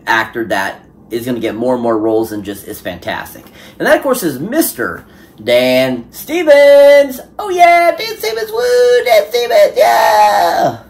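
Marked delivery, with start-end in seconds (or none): 3.8-7.2 high, loud, drawn-out announcer build-up; announcer reveal. Pretend. Vlogger unveiling his favourite actor in a loud, slow announcer voice. 7.4-10.8 high, loud whooping cheer; hyped cheering. Pretend. Vlogger whooping for Dan Stevens, a high, loud cheer.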